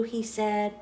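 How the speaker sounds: ordinary delivery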